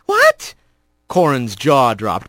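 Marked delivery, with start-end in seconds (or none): 0.0-0.5 shocked cry. A narrator's reading. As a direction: squeal in disbelief. Corin shocked at grounding.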